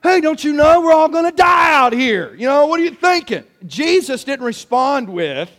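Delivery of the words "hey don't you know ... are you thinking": higher-pitched acted shout of alarm; loud, urgent mock panic; panicked disciple. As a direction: yell it as a frightened, reproachful disciple. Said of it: "they're like panic mode".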